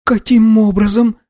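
put-on high, timid girl's question; timid babble. A narrator's reading. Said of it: "пролепетала Анна".

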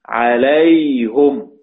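عليهم said "alayhum", very drawn out; damma on the ha. Shown as a demonstration. Teacher showing Hamza's reading, عليهم as slow "alayhum". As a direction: stretch عليهم slowly to a "-hum" ending. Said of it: "قرأها بالضم".

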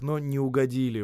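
plain reading voice

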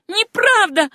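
high, loud denial; shouted. A narrator's reading. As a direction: cry out against the lie. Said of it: "крикнула Сюзанна". (высокий громкий выкрик)

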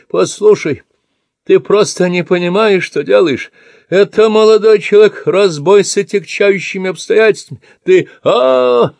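put-on high, loud yelling old man; shouting. Old man yelling at his attacker from the bed. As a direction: shout it out at the intruder, high and strained. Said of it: "кричит".

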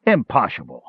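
curt gruff dwarf dismissal. A narrator's reading. Dwarf repeats.

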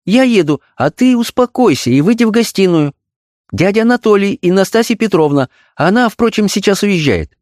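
high, bright-pitched cheer; chirpy glad wife; delighted. A narrator's reading. Wife glad to leave for the theatre. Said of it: "обрадовалась".

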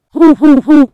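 a loud hammed-up boo-hoo cry; loud boo-hoo sobbing. A narrator's reading. Director who lost the quiz wails loudly, loud hammed-up sobbing. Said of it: "Bỗng dưng ông ấy bật khóc".

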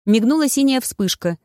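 ordinary delivery

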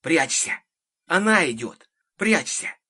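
urgent, high-pitched alarm; anxious warning. A narrator's reading. Child warning others the witch is coming, anxious. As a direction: warn them frantically to take cover. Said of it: "короткий свист и тревожный шёпот".

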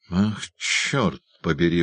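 plain reading voice

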